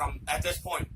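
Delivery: plain reading voice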